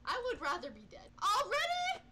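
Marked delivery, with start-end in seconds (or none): 1.2-2.1 high loud outburst; shocked. Real, not acted. Gamer losing already, high shocked cry. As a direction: blurt it in disbelief. Dies early.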